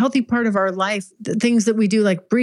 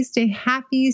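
ordinary talk

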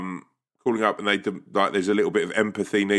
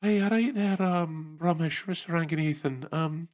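put-on Edinburgh accent; 9 semitones higher than usual. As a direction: put on a high-pitched, gentle Edinburgh lilt. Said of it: "push this up to Luke up in Edinburgh".

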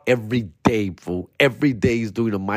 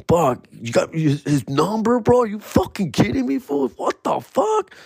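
climbing higher to a squeak; giddy disbelief; 11 semitones higher than usual. Retold or imitated. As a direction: let the pitch run away in disbelief. Disbelief at phone number.

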